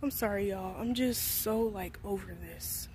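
low, soft, fed-up admission; tired apology, dropping away at the end; weary. Real, not acted. Worn out, venting about school.

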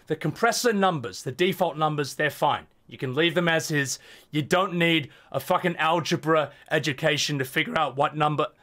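ordinary delivery

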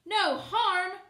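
loud, high outraged retort; indignant cry. A narrator's reading. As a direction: throw her words back at her. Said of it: "cried one who seemed".

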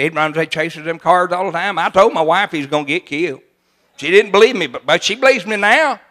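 normal talking voice

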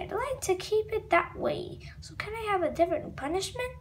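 ordinary delivery

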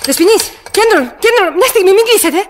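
panicked pleading call; urgent calling out. Acted. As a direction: call out fast to stop the line closing. Woman begging operator not to hang up.

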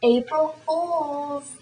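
high, drawn-out mock announcement; gleeful prank reveal. Revealing the prank to her friend, gleefully drawn out. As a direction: stretch it out high to spring the joke.

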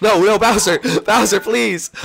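high, loud pleading; panicked pleading. Real, not acted. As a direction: beg Bowser to stop. Being attacked by Bowser.

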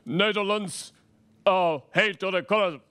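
low, stern king decree; dictator king voice. Retold or imitated. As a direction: growl it out like a tyrant. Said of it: "the Dutch king said".

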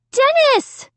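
high, loud cry of a name; cri. A narrator's reading. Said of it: "I cried".

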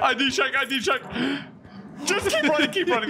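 high, panicked calling; frantic while running; being chased. Real, not acted. Fleeing from chaser.

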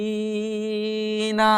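হাফিনা with হা held very long on a level note, ফি held shorter; four-alif stretch. Shown as a demonstration. Teacher showing long and short madd, হাফিনা with হা drawn out. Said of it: "এটা চার আলিফ, এটা এক আলিফ".

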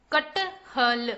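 कटहल stretched slowly as क-ट-ह-ल; syllable by syllable. Shown as a demonstration. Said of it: "चलिए बच्चों, पढ़ते हैं।".

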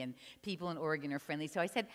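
ordinary delivery, nothing marked

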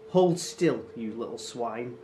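loud burst dropping to a growled insult; snarled. A narrator's reading. Henchman wrestles struggling boy, snarled. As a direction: hit the command, then sink on the insult. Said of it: "Grim snarled".

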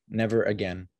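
usual speaking voice

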